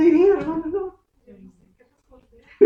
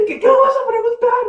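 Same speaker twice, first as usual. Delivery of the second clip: much higher, fast, panicky squeak; nervious imagined reply; 10 dB louder than usual. Pretend.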